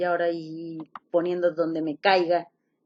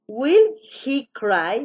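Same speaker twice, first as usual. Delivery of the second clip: "Will he cry?" drawn out slowly with a rise at the end; teacher echoing the student's answer slowly; slow model question; 5 semitones higher, 6 dB louder, much slower than usual. Shown as a demonstration. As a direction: spell out "Will he cry?" word by word. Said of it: "Muy bien, excelente".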